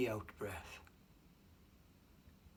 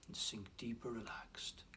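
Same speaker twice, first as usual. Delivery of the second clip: barely audible, softer hypnotic whisper; 2 semitones lower, 9 dB quieter than usual. Acted. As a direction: let the words sink away into a hush. Whispered suggestion to go deeper.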